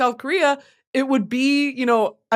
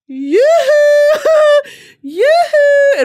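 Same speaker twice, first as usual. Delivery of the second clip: very high, loud sing-song call, breaking into a laugh; flirty yoo-hoo; 9 dB louder, much slower than usual. Pretend. Calling to airmen at the gate, high laughing flirty yoo-hoo. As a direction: call out like a showgirl waving at sailors. Calling to servicemen outside base.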